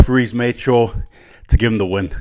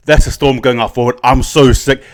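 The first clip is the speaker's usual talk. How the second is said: ordinary talk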